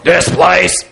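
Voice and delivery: deep gravelly football coach impression; imitated deep, rough coach's drawl. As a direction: growl it out like a gravel-throated coach. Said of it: "deep, gravelly voice".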